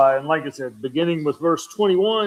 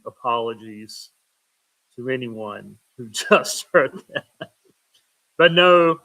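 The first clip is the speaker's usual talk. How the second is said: ordinary talk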